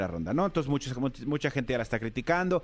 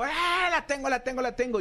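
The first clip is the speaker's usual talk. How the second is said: high excited mock yell, la tengo repeated; 9 semitones higher than usual. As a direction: yell it like a fan who just caught the ball. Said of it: "grita así como".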